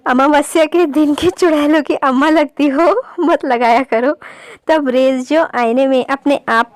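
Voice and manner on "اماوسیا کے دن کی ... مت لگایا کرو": teasing through laughter. Real, not acted.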